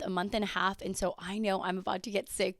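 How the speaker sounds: ordinary talk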